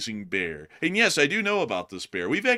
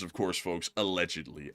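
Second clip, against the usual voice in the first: quiet aside; 6 semitones lower, 7 dB quieter than usual. Shown as a demonstration. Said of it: "That's the magic word".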